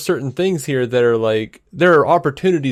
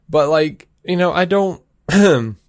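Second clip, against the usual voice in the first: usual speaking voice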